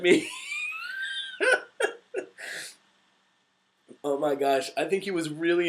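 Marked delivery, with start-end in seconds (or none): 0.3-2.7 very high, squealing laugh; helpless laughter. Real, not acted. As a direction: crack up at your own blunder. Laughs at own embarrassing story.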